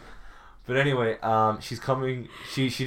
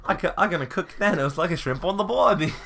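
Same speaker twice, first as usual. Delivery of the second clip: mock Australian; 7 semitones higher, 4 dB louder than usual. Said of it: "sorry to anyone that's Australian".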